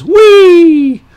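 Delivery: high, loud, drawn-out whee; gleeful whee. Retold or imitated. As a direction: squeal it like a kid on a slide. Polar bear down slide.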